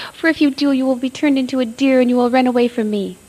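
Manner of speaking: high, loud desperate plea; frightened cry. A narrator's reading. Little sister begs brother not to drink, high and loud. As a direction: cry out to stop him. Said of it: "she said".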